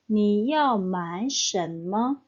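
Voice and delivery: slow, careful Mandarin model read; slow model sentence. Shown as a demonstration. Teacher reading an example sentence to students, slow and careful. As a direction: spell it out slowly for learners. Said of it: "contoh kalimatnya".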